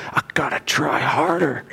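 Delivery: whispered to herself, under the breath; a private vow whispered to herself; whispered resolve. Acted. Voicing Cheryl's inner resolve.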